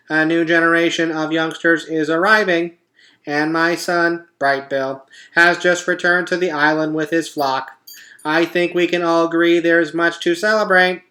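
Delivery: a cheerful machine making a speech; robot voice. A narrator's reading. Roz announcing the party truce to the animals, perky robot.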